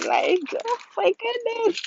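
squealed oh my goodness; high squeal. Real, not acted. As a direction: shriek it in embarrassment. Embarrassed exclamation, squeal.

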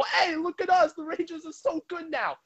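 high, whiny mock voice of a gloating Rangers fan; mocking bragging fan. Rangers fans in new jerseys gloating, high mocking voice. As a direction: sneer it like a smug fan showing off. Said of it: "and go".

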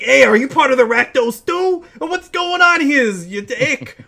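high, loud, snarling goblin; a furious little goblin demanding answers; angry goblin voice. Acted. GM voices the goblin confronting the party, high angry snarl. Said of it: "the goblin is looking like".

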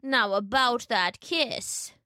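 slow, drawn-out coaxing; wolf as grandmother. A narrator's reading. Wolf luring Little Red closer, slow and coaxing. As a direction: coax slowly as a fake granny.

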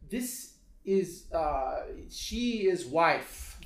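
put-on halting broken English, Lebanese accent, drawn out; hesitant Lebanese accent. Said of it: "he was just hesitating".

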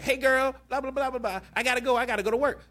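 put-on high, sassy girlfriend voice rattling off chatter; gossipy best friend. Best friend leaving for work, high sassy girlfriend voice. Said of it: "the best friend is like".

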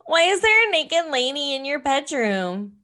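high-pitched nasal daughter; daughter's voice. Acted. Daughter asking dad about lady in bedroom, high voice. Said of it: "That's her voice".

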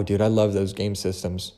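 ordinary talk, nothing marked

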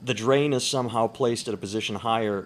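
ordinary talk, nothing marked

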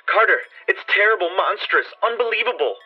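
high, frantic cry of horror; panicked voice cracking upward; frenzied terror. Acted. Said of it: "Then came the frenzied tones again".

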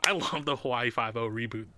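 ordinary delivery

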